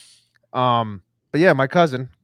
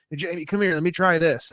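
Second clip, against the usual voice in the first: imitating older brother; 7 semitones higher than usual.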